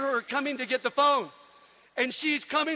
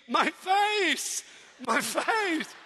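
mock scream; 6 semitones higher, much slower than usual. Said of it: "He's screaming".

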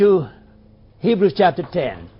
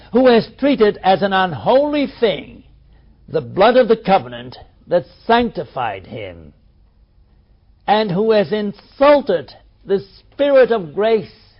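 firm, louder Bible reading; scripture reading; 2 semitones higher, 4 dB louder than usual. Acted. Preacher reading Hebrews aloud to his class, firm. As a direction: proclaim the law of Moses.

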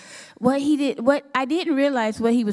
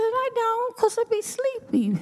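put-on squeaky little-girl voice, much higher; high childish excuse; 12 semitones higher than usual. Telling God why she never smokes at night, in a high childish voice. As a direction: play it like a sleepy kid making excuses.

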